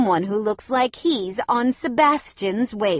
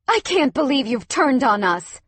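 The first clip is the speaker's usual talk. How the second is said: louder, higher indignant cry; 6 semitones higher, 7 dB louder than usual. A narrator's reading. Claudia crying out at India and Rod. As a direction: cry it out in hurt disbelief. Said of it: "Claudia cried".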